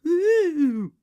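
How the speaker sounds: high, loud drawn-out wail; mock ghostly "ooh". A narrator's reading. Susan mimics her dull role, mock wail. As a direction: sourly mimic a silly part. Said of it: "walk about going 'Ooh'".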